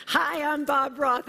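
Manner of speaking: high, bright mock-friendly hello; cheery greeting. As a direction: imitate a beaming, upbeat visitor. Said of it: "He goes".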